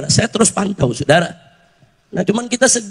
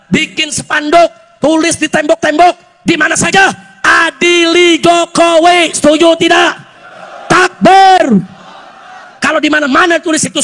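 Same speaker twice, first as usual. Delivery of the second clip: raised voice building to a loud shout; rallying oratory; 4 semitones higher, 5 dB louder than usual. Real, not acted. Preacher urging followers to spread the slogan, building to a shout. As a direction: rouse the crowd, stretching the slogan out.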